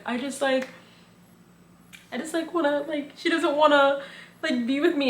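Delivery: high, soft, halting mock-tearful voice; imitated crying. Man confiding in Leah and Serena, imitated tearful pleading. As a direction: lightly imitate someone breaking down mid-sentence. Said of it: "he just starts crying".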